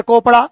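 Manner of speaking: कोपड़ा said with a hard k instead of ch; mock wrong name. Shown as a demonstration. Teacher mocking a k-sound reading of the name चोपड़ा. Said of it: "तो कहिए mister".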